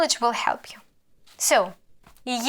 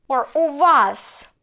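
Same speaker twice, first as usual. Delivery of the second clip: ordinary talk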